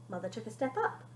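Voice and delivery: ordinary delivery, nothing marked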